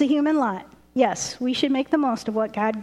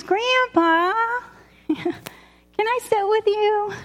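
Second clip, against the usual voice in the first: put-on little girl voice; 8 semitones higher, slower than usual.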